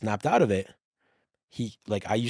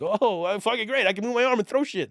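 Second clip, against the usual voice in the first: overjoyed; 10 semitones higher than usual. Retold or imitated. Retelling joy at moving arm.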